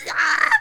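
startled cry. Retold or imitated. Startled when SWAT yelled.

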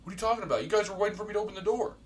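high, fast, defensive. Retold or imitated. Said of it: "He goes".

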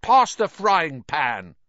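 high, loud, slow demand; bossy spoilt son. A narrator's reading. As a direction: order it like a spoilt child. Spoilt Dudley orders Harry about.

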